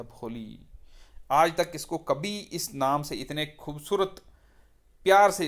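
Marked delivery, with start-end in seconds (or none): 0.0-0.7 much softer, tender call of भोली; whisper-soft call of the name to soothe her; soft loving call. Retold or imitated. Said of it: "बड़े प्यार से इसको बोला गया था". (टीचर की धीमी प्यार भरी पुकार)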